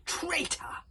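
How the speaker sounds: high, loud furious bellow; furious dragon shouting traitor; dragon roar. Acted. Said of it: "roared the Dragon Furious".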